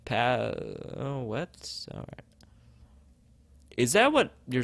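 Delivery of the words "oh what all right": ordinary talk, nothing marked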